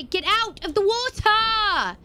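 a long, high, slow yell; high drawn-out yell. Real, not acted. Yelling at creature again.

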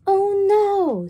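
high, loud worried cluck; alarmed hen. Acted. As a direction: gasp it like a fussing hen. Hen voiced in children's story.

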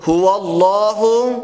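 الله with a full heavy lam, chanted slowly; الله sung with emphatic lam; heavy lam in Allah. Shown as a demonstration.

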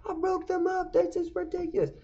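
very high, squeaky mock-gleeful voice; gleeful mom. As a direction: crow about it in a silly high voice. Mom dancing around celebrating.

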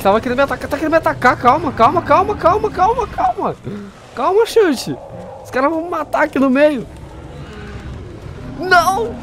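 very high, squeaky, frantic pleading; panicked pleading. Real, not acted. Player panicking as a dinosaur attacks him. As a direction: beg the dinosaur to calm down.

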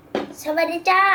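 slow, loud and clear for the toy; speaking to a toy. Real, not acted. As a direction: spell it out slowly for the parrot. Child speaks to talking parrot toy.

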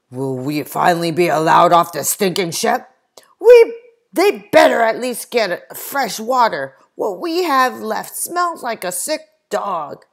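gentleman's loud indignant grumble; loud complaint. Acted. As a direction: demand it with disgust. Gentlemen complain below deck.

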